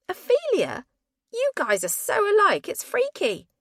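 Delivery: high, loud amazed voice. Acted. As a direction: gasp at the likeness. Friend amazed at likeness.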